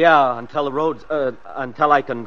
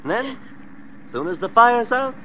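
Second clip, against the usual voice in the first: higher, sneering sing-song; mocking gloat; 6 semitones higher than usual. Killer mockingly promises the family a picnic, sneering. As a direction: taunt them with fake cheer.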